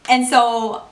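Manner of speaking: normal talking voice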